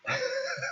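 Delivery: very high, soft squeaky giggle; odd laughing noise. Real, not acted. Host surprised by his own noise, odd squeaky laugh. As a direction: let out a strange little high-pitched laugh. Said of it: "I don't know what that noise was".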